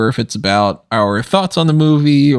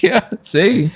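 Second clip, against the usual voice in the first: a hint of a higher voice for his wife; imitating wife; 7 semitones higher than usual. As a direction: lightly voice his wife, pointing it out.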